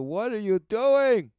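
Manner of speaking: high, loud, slow cry of alarm; the spirit calling out. Acted. Commentator voicing the inner spirit crying out, high and loud. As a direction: call out like a startled inner spirit. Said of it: "the life inside of you calling out to you".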